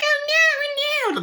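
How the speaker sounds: high, slow squealing "oh no"; mock panic. Bystanders fleeing gunfire, high mock panic.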